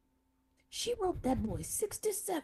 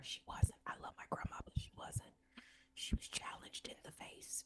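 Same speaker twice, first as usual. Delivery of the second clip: whispered, much softer aside; hushed confession; 10 dB quieter than usual. Real, not acted. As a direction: whisper it like an unkind family secret. Hushed confession about grandma's looks.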